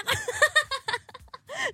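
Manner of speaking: high-pitched cackling laugh; guinea-fowl cackle. Real, not acted. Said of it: "c'est quoi ce rire de pintade".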